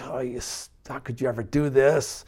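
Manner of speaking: imagined Jesus reproaching Peter with disgust. Pretend. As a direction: let the disgust seep out under your breath. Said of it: "with a look of disgust".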